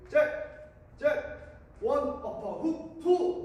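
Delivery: rhythmic shouted workout calls; boxing coach calls. Shown as a demonstration. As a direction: count the punches like a coach.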